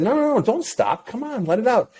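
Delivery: high-pitched, pleading encouragement; urgent coaxing. Retold or imitated. Dad begging his son to keep crying, urgent coaxing.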